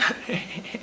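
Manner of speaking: a quick high breathy laugh; breathless laugh. Real, not acted. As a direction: laugh at own attempt. Laughs after own impression.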